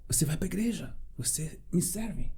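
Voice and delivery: a soft, unvoiced whisper; a mysterious voice whispering to the discouraged young man. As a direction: whisper it like an unseen voice tugging at his shirt. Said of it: "Ouvi vozes".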